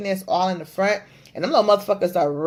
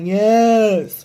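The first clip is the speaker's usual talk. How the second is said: put-on deaf voice on one word; feigned deaf person's reply; imitated deaf speech; 4 dB louder than usual. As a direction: answer as if pretending to be deaf. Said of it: "I had to act like I was deaf".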